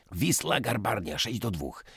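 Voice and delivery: ordinary delivery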